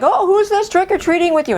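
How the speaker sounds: put-on high-pitched child asking; curious kid. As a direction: ask like a nosy young boy. Curious kid meets the group.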